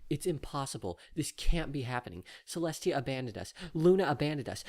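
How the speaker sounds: high, breathless despair; a frightened pony losing hope; lightly voiced panicking mare; panicked mare.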